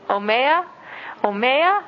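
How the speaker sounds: asking someone at home for water, rising omeya; pleading ask for water. Shown as a demonstration. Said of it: "you only have to say it one time".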